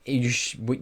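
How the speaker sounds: ordinary talk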